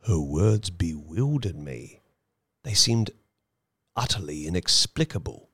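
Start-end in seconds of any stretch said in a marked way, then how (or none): none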